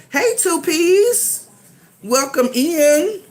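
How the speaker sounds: high, slow, sing-song greeting; yelling across the room. Real, not acted. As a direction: call out a welcome. Greeting viewer in live chat.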